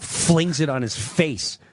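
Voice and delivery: normal talking voice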